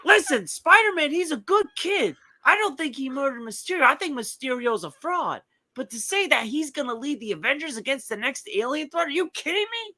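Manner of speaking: high, excited radio rant; Mad Dog impression. Doing Mad Dog on Spider-Man as a caller, high-pitched rant. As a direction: harangue the callers like a talk-show host. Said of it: "he'd be like".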